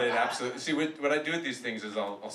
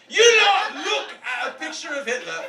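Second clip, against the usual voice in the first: loud high put-on accent, laughing; shouted mock accent bit; 11 semitones higher, 9 dB louder than usual. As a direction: belt the broken-English line out high. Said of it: "best experiences of my life".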